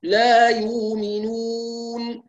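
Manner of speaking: very slow chanted لا يؤمنون with hamza turned to waw; ترتيل بطيء; Susi recitation. Shown as a demonstration. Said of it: "فيقول".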